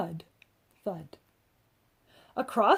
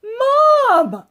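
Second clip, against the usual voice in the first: loud, high shout of Mom; child yelling for his mother; yelled; 14 semitones higher, 12 dB louder than usual. A narrator's reading. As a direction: call out to mother in alarm. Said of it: "I yelled".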